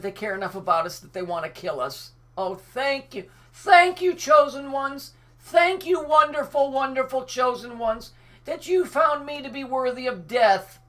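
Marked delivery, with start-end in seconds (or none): 2.4-10.8 high, loud mock-grateful gushing; sarcastic gratitude. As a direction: fawn over them in bitter mockery. Sarcastic thanks to chosen ones.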